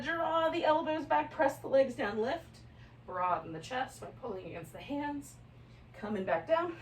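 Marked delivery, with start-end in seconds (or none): none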